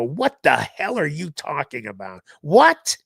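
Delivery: retold disbelief building to a loud, high "What?"; incredulous outburst. Dad overheard in the other room, imitated incredulous outburst. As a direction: imitate dad erupting, last word shouted high.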